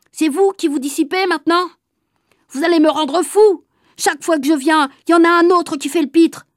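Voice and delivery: mild angry surveillant; exasperated supervisor. A narrator's reading. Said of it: "il a dit le bouillon". (surveillant un peu excédé)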